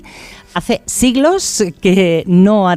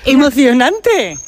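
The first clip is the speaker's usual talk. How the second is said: slow, higher incredulous echo; surprised; 7 semitones higher, slower than usual. Real, not acted. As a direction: echo the word back, amazed. Repeats the guest's odd word. (el presentador repite la palabra, sorprendido)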